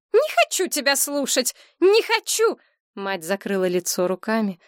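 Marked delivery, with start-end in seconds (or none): 0.1-2.6 much higher, rising to a shout; refusing cry. A narrator's reading. Mother refusing to hear her daughter's accusations, crying out. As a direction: shut her out.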